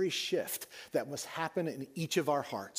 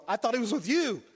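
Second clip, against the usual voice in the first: high, loud startled reply of a father; panicked Joseph; 9 semitones higher, 7 dB louder than usual. Retold or imitated. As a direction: blurt it out as the sudden realisation hits. Joseph answers in sudden panic.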